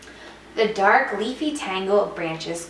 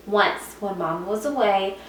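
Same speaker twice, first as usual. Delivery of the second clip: usual speaking voice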